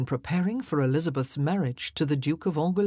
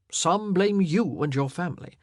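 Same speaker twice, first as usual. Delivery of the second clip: louder, emphatic royal report; grave king's voice, rising in force; troubled, forceful king; 2 semitones higher, 4 dB louder than usual. Acted. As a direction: deliver grim findings with growing indignation.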